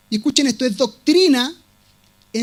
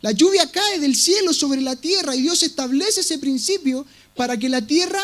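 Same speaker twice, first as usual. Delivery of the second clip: ordinary talk, nothing marked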